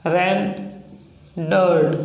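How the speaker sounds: रेंडर्ड drawn out very slowly; slow demo of रेंडर्ड. Shown as a demonstration. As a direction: stretch out रेंडर्ड. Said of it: "जैसे आपका example है".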